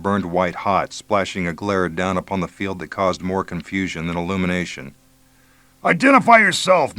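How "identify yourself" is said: high, loud command; shouting. A narrator's reading. Major challenging hidden attackers, loud shout. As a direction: shout the demand into the trees. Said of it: "Major Jakes shouted".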